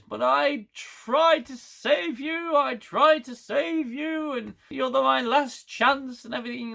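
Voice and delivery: hammed-up drunk father wailing to his daughter; pleading drunk dad. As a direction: stretch each word like a broken drunk. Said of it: "And he goes".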